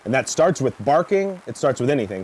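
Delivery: ordinary talk, nothing marked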